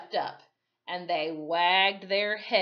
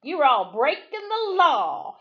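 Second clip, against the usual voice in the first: booming at a rowdy crowd, pitched up; thundering; 7 semitones higher, 8 dB louder than usual. A narrator's reading. Sheriff thundering over a grumbling crowd, loud. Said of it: "he thundered over the grumbling crowd".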